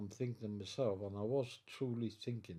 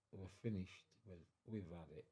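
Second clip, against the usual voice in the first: low, sinking voice, trailing softer; resigned despair; 3 semitones lower, 10 dB quieter than usual. Retold or imitated. Sailor recalling the sight of U-boats, resigned despair. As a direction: let it drop as if all is lost. Retelling his despairing thought.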